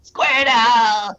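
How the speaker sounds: high, loud, drawn-out creature cry; Pokemon voice. Shown as a demonstration. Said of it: "different voices that I have provided".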